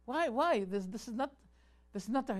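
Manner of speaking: a touch of mock outrage; imitating outrage. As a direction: ham up an indignant cleric. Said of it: "he was outraged".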